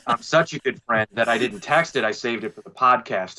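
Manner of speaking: ordinary talk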